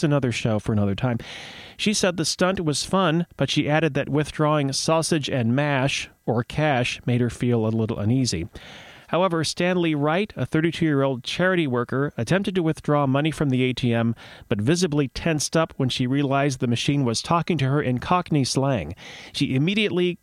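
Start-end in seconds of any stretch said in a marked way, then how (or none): none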